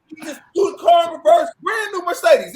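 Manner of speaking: high, loud, panicked telling of the crash; half-shouting in remembered panic; panicked yelling. Retold or imitated. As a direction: voice cracking up and loud as it all comes back.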